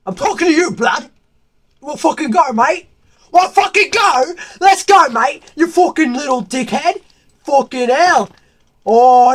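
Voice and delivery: mock British lad picking a fight, high and loud; loud mock British pub-brawl challenge; mock British shouting. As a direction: bellow like a rowdy British yob, "mate!". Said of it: "I'm British".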